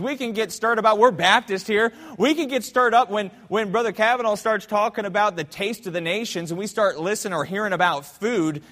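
loud, high, fired-up preacher; preaching at full force. Acted. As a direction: stir the church up, pushing hard. Preaching fires up the congregation.

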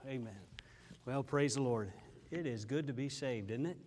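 usual speaking voice

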